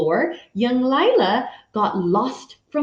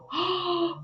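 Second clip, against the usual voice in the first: quiet sharp intake of breath; hushed gasp; soft gasp; 6 semitones higher, 8 dB quieter than usual. Real, not acted. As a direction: gasp in dismay.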